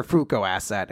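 normal talking voice

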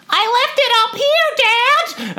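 put-on very high, drawn-out kid squeal; Jonah. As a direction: imitate a child calling to a parent, slow and piercingly high. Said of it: "Would you like my Jonah impression?".

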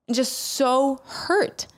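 sharp wounded gasp, slow; hurt gasp. Retold or imitated. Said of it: "saw little Brenda going".

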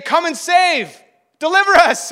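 high, loud calling out; loud, impassioned crowd chant; crowd's plea. Acted. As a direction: cry out to be rescued. Crowd crying out for rescue.

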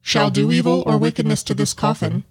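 dark voice reading a curse; ominous curse voice. Acted. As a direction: intone it like an ancient curse. Reading tomb curse aloud.

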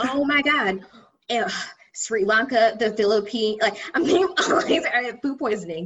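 high, loud, grossed-out laughter; excited disgust. Real, not acted. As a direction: squeal out the gross memory. Disgusted recalling food poisoning.

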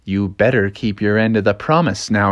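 low warning voice; Bubbles. Acted. Winking fish reminds bear of promise, low warning. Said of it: "he saw Bubbles".

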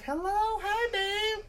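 very high sweet falsetto; imitating his girlfriend. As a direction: squeak it like his girl on the phone. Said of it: "She's like".

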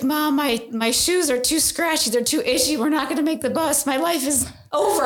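put-on high, whiny kid complaining; a little girl's dramatic meltdown; whiny child.

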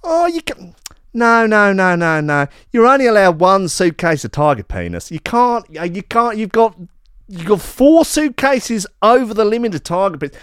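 high-pitched, exasperated Australian official; incredulous mock-Australian scolding, pitched high; Australian border officer. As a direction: do a hammed-up Aussie customs officer telling someone off. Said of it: "an Australian gentleman is like".

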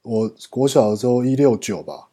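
ordinary talk, nothing marked

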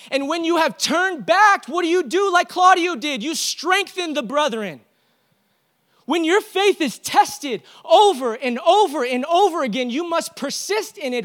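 loud, high-pitched preaching to the church; rally the congregation with fire; full-force preaching. Acted.

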